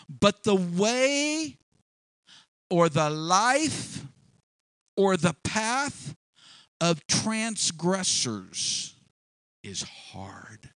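slow, drawn-out, each phrase set apart by long pauses; dramatic scripture reading. Acted. Preacher quoting Proverbs dramatically.

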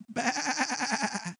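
high, quavering bleat; sheep bleat. Acted. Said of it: "Anybody ever seen sheep?".

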